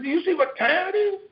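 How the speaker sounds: high-pitched incredulous question; retold disbelief, voice climbing high; exasperated. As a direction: scold a late friend, pitch way up. Retold exasperated confrontation with late friend.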